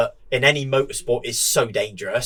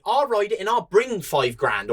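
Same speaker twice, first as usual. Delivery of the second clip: mimicked rival rider; 8 semitones higher than usual.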